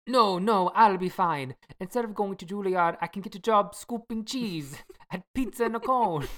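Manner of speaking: lightly put-on Italian accent; a hint of Italian lover. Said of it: "my Italian accent".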